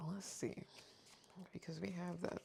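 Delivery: murmured aside. Real, not acted. Muttering to herself while checking cards.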